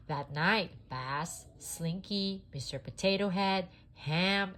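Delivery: plain reading voice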